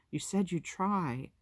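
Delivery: gently reproach, with worry in it; worried. A narrator's reading. Sister reminding her little brother of his promise, worried. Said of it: "couldn't keep the worry out".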